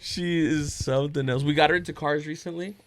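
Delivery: normal talking voice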